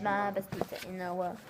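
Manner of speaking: soft, low murmur; quiet. Real, not acted. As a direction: say it softly to yourself. Quiet repeat of the phrase.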